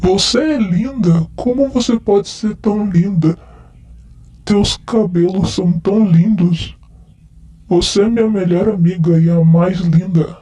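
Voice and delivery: lightly slurred, tipsy declarations of love; drunk man. Acted. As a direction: play a drunk guy sweet-talking his friend. Nossa, eu tô muito bêbado.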